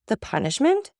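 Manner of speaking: high, horrified echo of the word; shocked exclamation. A narrator's reading. Wife horrified by the word punishment, high exclamation. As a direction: recoil at the word in alarm. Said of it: "exclaimed Madame de Villefort".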